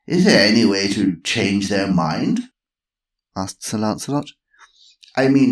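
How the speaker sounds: fond of history; Sir Lancelot. Acted.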